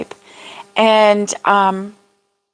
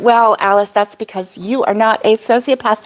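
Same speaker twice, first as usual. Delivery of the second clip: usual speaking voice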